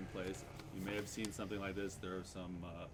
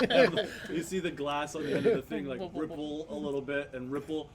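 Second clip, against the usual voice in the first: laughing; 4 semitones higher, 9 dB louder, faster than usual. Real, not acted. GM still laughing.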